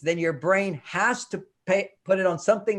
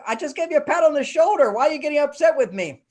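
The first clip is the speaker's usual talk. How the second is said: higher, louder acted protest of innocence; mock puzzled protest; 8 semitones higher, 4 dB louder, faster than usual. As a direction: play the confused friend defending himself. Acting puzzled friend.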